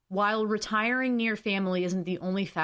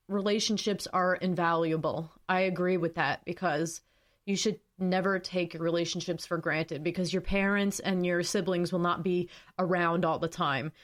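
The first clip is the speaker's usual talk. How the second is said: ordinary talk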